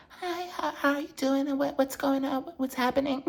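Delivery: high, hushed imitation of Jennifer Tilly; Jennifer Tilly impression. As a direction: pitch way up and go soft, doing her famous voice. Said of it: "I just love her voice".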